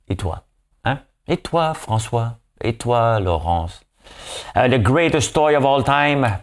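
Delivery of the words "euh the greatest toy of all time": announcer voice. Acted. Grand English announcement.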